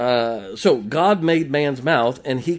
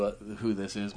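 normal talking voice

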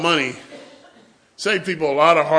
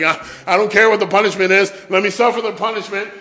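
put-on quick, higher, louder begging kid; fast mock plea; 5 semitones higher, 7 dB louder, faster than usual. Preacher voices his kid self begging for punishment over a lecture, fast. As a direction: rush it out like a kid who wants it over.

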